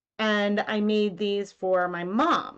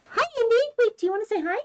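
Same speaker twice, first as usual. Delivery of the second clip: much higher sing-song voice for the dog; dog talk; much faster than usual. Real, not acted. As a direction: coo a hello up high to the pup. Greeting the dog on camera.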